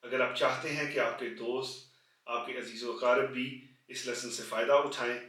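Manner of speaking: quiet sign-off asking viewers to share; soft outro. Acted. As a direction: wind down the lesson gently.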